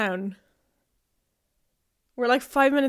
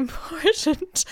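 laughing; 4 semitones higher, slower than usual. Retold or imitated. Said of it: "I was like".

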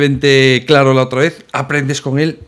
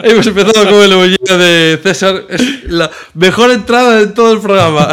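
loud, higher-pitched, laughing talk; 4 semitones higher, 6 dB louder than usual. Real, not acted. Co-host laughing at the show's botched best-ever opening, laughing loudly. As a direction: crack up while getting the words out.

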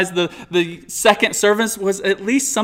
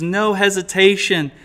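ordinary talk

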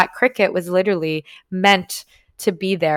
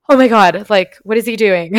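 louder, higher burst of alarm; startled exclamation; 4 semitones higher, 8 dB louder than usual. Retold or imitated. Watching the guy grab the cricket, louder startled alarm. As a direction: blurt it out in shock.

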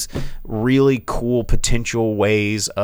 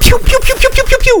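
gunshot noises; 10 dB louder, faster than usual. Retold or imitated. Hitman shooting teenagers.

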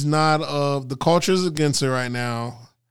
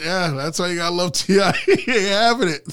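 higher-pitched, breaking into real laughter; laughing; 4 semitones higher than usual. Real, not acted. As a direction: say it through a growing laugh. Amused at T.I.'s stubbornness.

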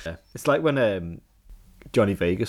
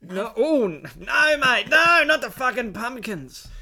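higher and louder, exasperated no mate; mock outrage; 13 semitones higher, 6 dB louder, much slower than usual. Friend refusing the pumpkin picker job, mock outraged. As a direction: protest like it's an insult, drawn out.

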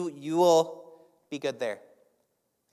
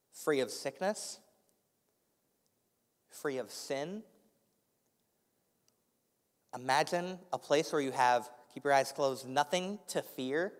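guided meditation. Acted. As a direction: guide them through a calm visualization. Leads a guided imagination.

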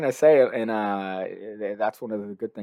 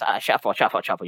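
put-on a light Spanish-accented magician patter; lightly imitated Spanish-accented magician; Danny D'Artis impression; 5 dB louder than usual. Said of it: "the Danny D'Artis".

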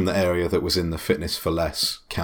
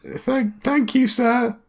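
put-on much higher, hesitant teenage clerk; gym clerk voice; 3 dB louder, slower than usual. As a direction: squeak out as the nervous acne-ridden clerk. Clerk thanks the players.

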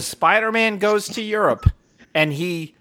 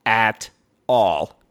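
slow, deliberate, flat-out honest; slow blunt verdict; 7 semitones lower, much slower than usual. Retold or imitated. To his wife about the movie, slowly and bluntly. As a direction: spell it out slowly, word by word. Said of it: "and I was like".

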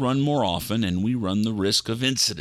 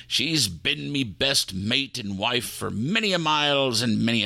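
put-on fond pirate accent, lightly done; 2 semitones higher, slower than usual. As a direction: talk about his ship lovingly in a pirate accent. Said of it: "that's an odd accent".

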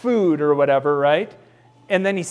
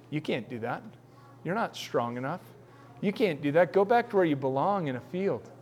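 much softer, belittling; dismissive brothers; 8 dB quieter than usual. Acted. Brothers telling David to go back to the field, soft and belittling. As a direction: quietly tell him he's too weak.

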